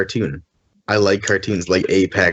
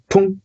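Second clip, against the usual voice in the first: game sound effect; 11 semitones higher than usual. Retold or imitated. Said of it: "It was like".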